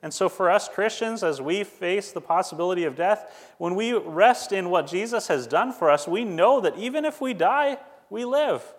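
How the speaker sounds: rising sermon peak; impassioned preaching. Acted. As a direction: lift them with hope. Climax of sermon.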